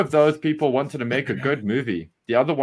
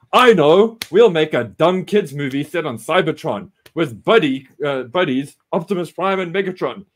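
excited boss; 2 semitones higher, 4 dB louder than usual. Retold or imitated. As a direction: blurt out the idea. Boss idea shout.

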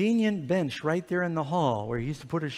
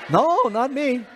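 loud, high mock little-boy denial; feigned innocence; 9 semitones higher, 7 dB louder, slower than usual. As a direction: play the guilty child protesting innocence. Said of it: "I'm going".

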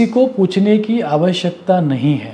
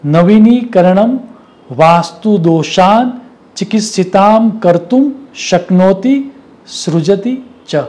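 measured recital of a Sanskrit line; Sanskrit verse recitation; 3 semitones higher, 4 dB louder than usual. Acted. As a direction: declaim the shloka word by word.

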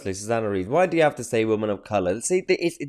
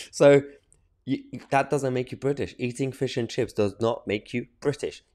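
usual speaking voice

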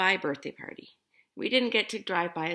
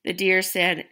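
ordinary delivery

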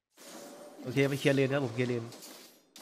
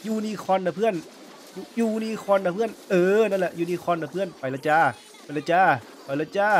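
higher, playful lilting calls; sing-song teasing; 5 semitones higher, 4 dB louder than usual. Real, not acted. Gamer teases the enemy unicorn skin in a singsong.

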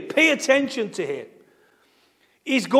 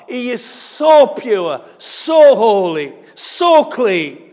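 drawn out, stressing each so; slow emphatic build; 5 dB louder, slower than usual. Real, not acted. Preacher to congregation, slow and weighty. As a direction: let each so land heavily.

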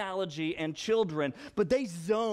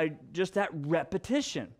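ordinary delivery

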